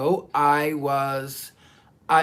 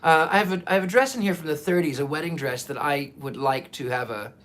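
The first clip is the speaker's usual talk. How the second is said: normal talking voice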